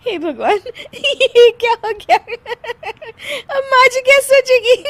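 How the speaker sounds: high, distressed words between giggles; helpless laughing. Acted. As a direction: fret while unable to stop laughing. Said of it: "हंसने वाली दवा खा लेती है". (बेबस हंसी के बीच ऊंची आवाज़ में घबराहट)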